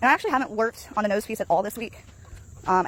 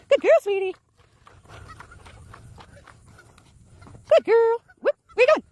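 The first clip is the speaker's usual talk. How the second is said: much higher, louder praise for the dog; 13 semitones higher, 7 dB louder than usual. Real, not acted. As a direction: cheer the dog on, sing-song high. Praising her dog on a walk.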